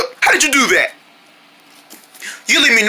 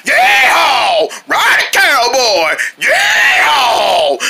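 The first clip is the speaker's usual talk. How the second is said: rodeo whoop; much slower than usual. Acted. Boy riding the armadillo, whooping cowboy holler. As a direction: whoop it up like a bucking-bronco rider.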